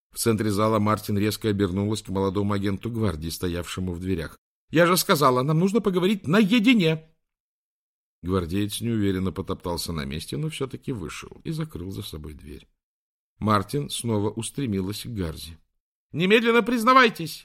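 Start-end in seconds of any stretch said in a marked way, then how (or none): 4.7-7.1 high, loud, impatient command; angry order. Acted. Martin orders guard out. 16.1-17.4 high loud yell; yelled demand. Acted. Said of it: "выкрикнула она".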